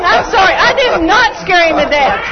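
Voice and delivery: high and loud with laughter; laughing. Real, not acted. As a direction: apologize while cracking up. Caught out, laughing.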